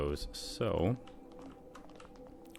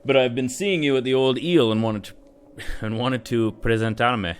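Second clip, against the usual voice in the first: put-on Spanish accent; 5 semitones higher, 6 dB louder than usual. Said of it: "it's gonna make me do it anyways".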